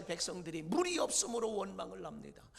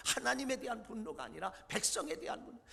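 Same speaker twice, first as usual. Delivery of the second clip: usual speaking voice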